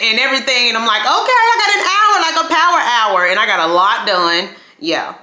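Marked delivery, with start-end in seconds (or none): 1.0-3.3 excited. Retold or imitated. Retold excited self-talk.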